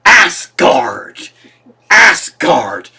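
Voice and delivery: drawn-out, punched two-syllable proclamation in an imitated voice; Jeff Goldblum. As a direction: imitate a grand, quirky movie-star line reading. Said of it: "because Jeff Goldblum says".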